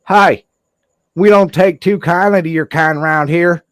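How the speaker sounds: mock tough-guy townie greeting. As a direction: sneer it like an unwelcoming local. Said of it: "I'm like".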